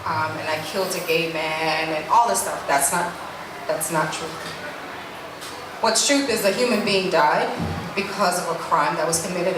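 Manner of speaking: ordinary talk, nothing marked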